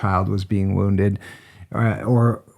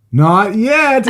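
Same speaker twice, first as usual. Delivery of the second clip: put-on high, loud kid's holler; imitated defiant child; 11 semitones higher, 6 dB louder than usual. Boy answering parents from inside the tent, high imitated yell. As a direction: yell back like a little boy mid-rampage.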